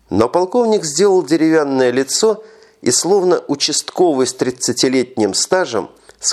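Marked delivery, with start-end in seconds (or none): none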